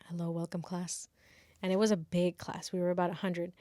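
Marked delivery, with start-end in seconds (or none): 0.1-1.1 hushed mock-solemn greeting; professor's whisper. Professor greets the class in a hushed whisper. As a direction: breathe the welcome like a whisper. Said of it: "he was like".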